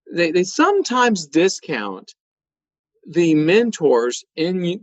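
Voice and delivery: ordinary talk, nothing marked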